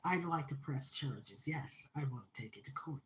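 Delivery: unvoiced whisper of resolve. Real, not acted. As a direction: breathe the words, firm but hushed.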